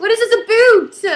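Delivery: high, loud overdone Canadian "about"; exaggerated Canadian accent. Actress mocks co-star's teasing, overdone Canadian accent. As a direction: ham up the Canadian vowel in "about". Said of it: "that's exaggerated".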